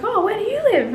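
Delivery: high, chirpy quoted neighbour. Retold or imitated. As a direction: sing it up like a clueless neighbour. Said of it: "he was like".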